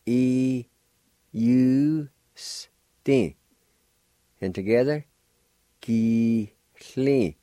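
Ki-hli then E-U-S-T said carefully part by part; two-part word demo. Shown as a demonstration. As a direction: build the word from its parts for learners. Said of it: "First part is pronounced like this".

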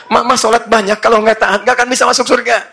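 loud, high-pitched telling-off; scolding husband. Acted. Husband scolding praying wife.

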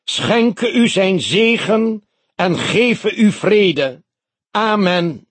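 high, loud and slow pulpit blessing; solemn preacher voice. A narrator's reading. Pastor blessing the mourners, loud and slow. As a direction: intone it like a sermon. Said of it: "sprak hij met grote stemverheffing".

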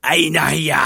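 loud, firm barked question; the leader pressing for an answer; stern and loud; firm demand. A narrator's reading. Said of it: "قال الدوبرمان بحزم".

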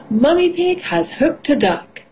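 plain reading voice

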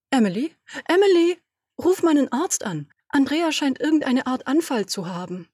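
laut und hoch nach Emily rufen; lautes Rufen. Acted. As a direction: ruft aufgeregt durchs Büro nach Hilfe. Ruft nach Assistentin Emily. (ruft laut und hoch nach der Assistentin)

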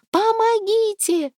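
high, slow desperate cry; shouting for help. A narrator's reading. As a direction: scream for help into the dark. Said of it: "закричала Лада".

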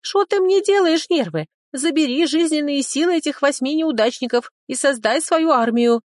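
very high, loud squeaky dragon; shrieking little creature, indignant. Acted. Little dragon answers the hero. (очень высокий голос дракончика)

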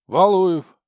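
loud, high herald call-out; town crier. Acted. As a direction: bellow the name across the cell. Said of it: "И уже голосом глашатая".

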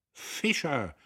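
high, sharp call by surname; sudden shout. A narrator's reading. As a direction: summon an employee abruptly. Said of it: "schrie er plötzlich". (plötzlich schreiend)

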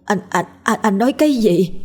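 halting, rising shock; stunned stammering. Acted. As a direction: trip over the first words in disbelief. Wife stunned by husband's impossible claim.

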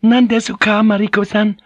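high-pitched, urgent Japanese woman; quick anxious question. A narrator's reading. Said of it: "Fujiko asked quickly".

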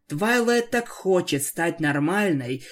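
usual speaking voice

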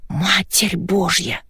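slow, hushed amazement; drawn-out, soft astonishment; awestruck gasp. A narrator's reading. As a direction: gasp it from behind the door. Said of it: "ахнула подслушивавшая под дверью Рокси".

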